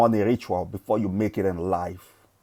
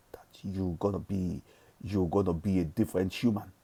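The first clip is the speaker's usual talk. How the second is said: whisper; 8 dB quieter than usual. Real, not acted. Whispered mindset advice.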